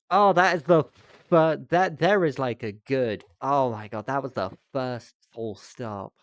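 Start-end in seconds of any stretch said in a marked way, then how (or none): 0.1-3.7 loud, high delighted outburst; excited shout. Real, not acted. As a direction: celebrate like a win. Thrilled at first full stop.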